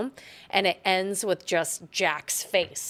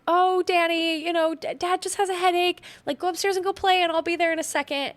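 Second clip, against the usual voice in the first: much higher, brightly put-on mother voice; imitating Wendy; 12 semitones higher, 4 dB louder than usual. Wendy shooing Danny off upstairs, much higher put-on voice. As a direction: shoo the child away sweetly and fast. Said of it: "she's like".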